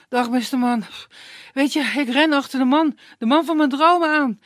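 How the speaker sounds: put-on high, loud and urgent girl; breathless young woman. Tearful girl begging the woodsman, high urgent voice. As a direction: pant it out, desperate.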